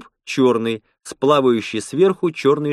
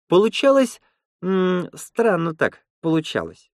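high-pitched woman's voice; Lena, enthusiastically explaining; 8 semitones higher than usual. Acted. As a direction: voice her lively account well above your pitch.

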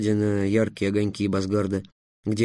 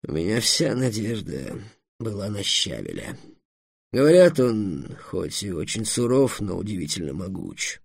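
dragon Эллен, woeful; 2 semitones lower than usual. Acted. (негромкий размеренный голос унылого дракона)

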